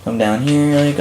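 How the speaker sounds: normal talking voice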